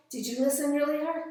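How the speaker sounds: ordinary talk, nothing marked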